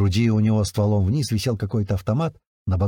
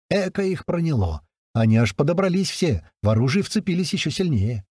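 ordinary delivery, nothing marked

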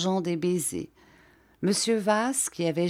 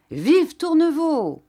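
high, slow, loud cheer; 11 semitones higher, 5 dB louder, slower than usual. A narrator's reading. The women cheer monsieur Tourneveau's return, loud and high. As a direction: hail him like a hero. Crowd cheers his entrance.

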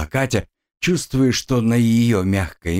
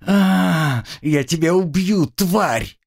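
pained angry yell; 8 semitones higher, slower than usual. A narrator's reading. Girl yells threats.

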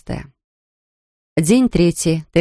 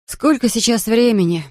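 higher, wailing in dismay; a mild wail of panic; 6 semitones higher than usual. A narrator's reading. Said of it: "взвыла Дженнис".

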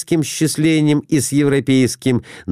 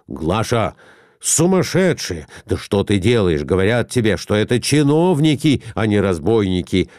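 plain reading voice

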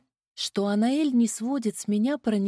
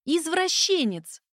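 slow, higher outraged cry; indignant exclamation; 6 semitones higher, slower than usual. A narrator's reading. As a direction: spit it out, scandalised. Heroine shouts insult.

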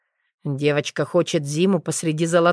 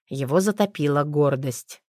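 ordinary delivery

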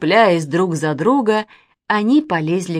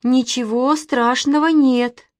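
slow, higher, uneasy reassurance; a touch of nervousness; trembling; 5 semitones higher, 4 dB louder than usual. A narrator's reading. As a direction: reassure while scared yourself. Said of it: "дрожа сказала Катя".

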